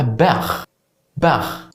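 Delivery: "باخ" ending in a throaty kh; Scouse k as kh. Shown as a demonstration. Teacher showing how Liverpool says back, "باخ" with final kh. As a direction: scrape the final sound of "باخ" like Arabic خ. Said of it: "بصوت الكي بتتحول لخ".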